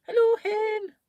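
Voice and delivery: high, loud Scottish call; Scottish greeting. Retold or imitated. Greeting a friend from Scotland, high Scottish accent. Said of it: "used to call each other hen".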